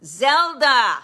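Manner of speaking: high, loud call to the back room; calling a girl from the back room, high and loud; yelling for Zelda. Retold or imitated. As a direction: shout for her to come quick. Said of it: "he yells to the back room".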